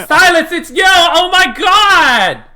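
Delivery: very high, loud fangirl scream; drawn-out squealing shout of delight; excited yelling woman. Acted. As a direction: shriek it, stretching the last words. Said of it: "and start yelling".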